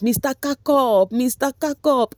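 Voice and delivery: high, slow, drawn-out calling of the son's name; mom calling out. Retold or imitated. Mom calling her son across the glass, high and drawn out.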